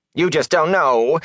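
high, slow whine; whining. A narrator's reading. Peddler complaining to the young villager, whining. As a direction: whine it with self-pity. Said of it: "Fane whined".

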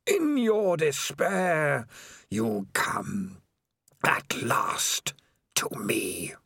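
eerie, slow, whispered menace; breathy voice from the dark; sinister whispering voice. A narrator's reading. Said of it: "a voice said".

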